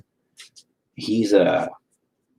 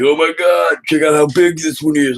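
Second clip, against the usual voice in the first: higher, louder, thrilled voice; excited kid; 7 semitones higher, 5 dB louder than usual. Retold or imitated. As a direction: show off a big find like a proud kid. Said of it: "He's always like".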